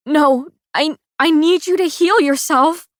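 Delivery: high, loud cracking plea; desperate crying plea; begging, voice cracking. A narrator's reading. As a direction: beg through a breaking voice. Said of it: "I beg, voice cracking".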